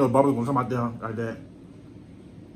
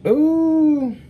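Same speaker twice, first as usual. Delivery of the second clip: squealed high, louder ooh of delight; 13 semitones higher, 4 dB louder than usual. Real, not acted. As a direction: coo at it in a high voice. Admiring the food.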